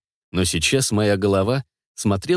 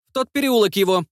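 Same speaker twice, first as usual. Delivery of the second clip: put-on high boy's voice giving orders; bossy kid calling out orders; child's voice command; 10 semitones higher than usual. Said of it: "всё тем же детским голоском".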